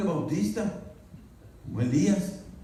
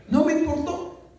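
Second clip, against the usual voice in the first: defiant high cry; 11 semitones higher than usual. Acted. Preacher defiant about dancing.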